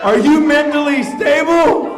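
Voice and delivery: loud, high incredulous shout. Acted. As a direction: shout the question at him. Champion shouts at referee.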